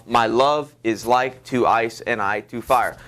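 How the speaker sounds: slow iambic beat, landing on "love", "like", "ice", "I", "fire"; emphasis on alternate syllables. Shown as a demonstration. Teacher showing emphasis on the syllables, slow alternating stress. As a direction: lean on every second syllable from "love" through "fire". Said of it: "you can see the emphasis".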